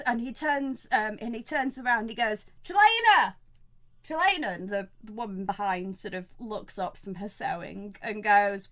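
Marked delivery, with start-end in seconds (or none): 2.6-4.5 raised, high call across the inn. Acted. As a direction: summon her from the back room. Innkeeper calls woman behind him.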